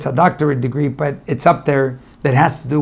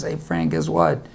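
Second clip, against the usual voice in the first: much lower and softer, a subdued greeting; sad; 11 semitones lower, 6 dB quieter than usual. Retold or imitated. Mayra greeting Frank while feeling down, subdued. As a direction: say it low and quiet, a bit down. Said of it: "a little bit on the sad side".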